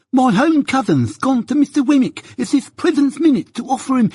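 a fawning petitioner scrambling after his man; frantic lisping suitor. A narrator's reading. Suitor chasing Jaggers down the street, frantically offering money.

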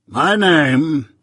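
Jaggers. Acted. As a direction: weigh each word like a London lawyer. Jaggers voiced by narrator.

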